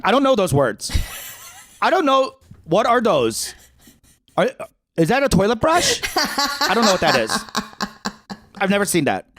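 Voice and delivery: high, laughing feigned ignorance; playing dumb. Teen denying he knows makeup, high mock-innocent. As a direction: act clueless about makeup, high and light. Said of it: "I was like".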